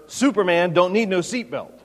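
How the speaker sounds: a cocky boxer's brag, lightly voiced; hint of a boastful Muhammad Ali; Ali's cocky quip. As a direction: toss it off like a swaggering champ. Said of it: "Ali purportedly quipped to her".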